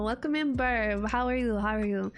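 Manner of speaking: usual speaking voice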